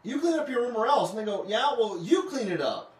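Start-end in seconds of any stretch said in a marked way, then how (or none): 0.0-1.1 loud, raised-pitch parent's warning; stern parent. Retold or imitated. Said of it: "kinda reminds you of, like, a, a teenager". 1.5-2.9 put-on loud, defiant teen retort; back-talking teenager. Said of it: "a teenager whenever they say".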